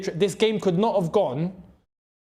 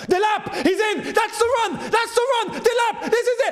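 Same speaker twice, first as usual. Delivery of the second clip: high, thrilled yelling at the screen; rising roar of hope as the chance opens; excited shout. Real, not acted. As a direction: cheer him through on goal. Striker breaks through on goal.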